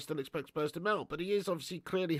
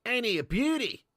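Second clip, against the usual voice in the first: hammed-up Aussie wildlife-host amazement; Australian accent; 4 semitones higher, 5 dB louder than usual. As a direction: marvel like an exaggerated Aussie bloke.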